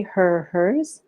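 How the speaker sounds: ordinary talk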